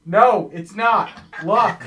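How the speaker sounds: slow, loud refusal; defiant shout. Real, not acted.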